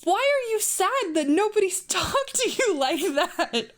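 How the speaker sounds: high, drawn-out wail through laughter; slow, squeaky, laughing incredulity; incredulous laughter. Real, not acted. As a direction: ask it in disbelief, cracking up. Baffled reader cracking up at story.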